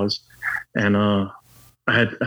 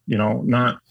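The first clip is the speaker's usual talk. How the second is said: ordinary talk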